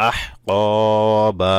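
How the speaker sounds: আহ ক বা with long ক and long open বা; drawn-out stop. Shown as a demonstration. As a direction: hold the final বা without an n. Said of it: "ওয়াকফ করলে". (আহ ক বা, শেষে বা টেনে)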